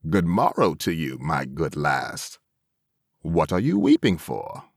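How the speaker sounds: lightly voiced hobgoblin. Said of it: "said the hobgoblin".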